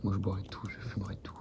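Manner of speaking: hushed conspiratorial whisper. Pretend. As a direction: whisper it like a secret. Whispered aside about the cult.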